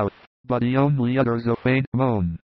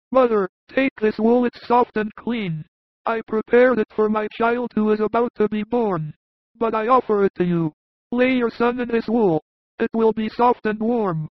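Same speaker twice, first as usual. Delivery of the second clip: bold shepherd; 10 semitones higher than usual. A narrator's reading. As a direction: offer the wool tenderly in a high voice. Narrator voices bold shepherd.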